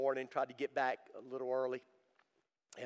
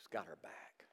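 hushed, breathy whisper of comfort; 10 semitones lower, 10 dB quieter than usual. Real, not acted. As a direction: lean in and whisper it softly. Preacher drops to intimate hush.

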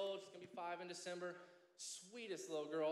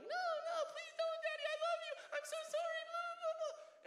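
very high, loud mock-crying little girl; whining, crying child; 5 dB louder than usual. Young daughter begging father not to spank her, imitated whiny crying. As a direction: squeal and beg in a hammed-up wail. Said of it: "She starts whining and crying".

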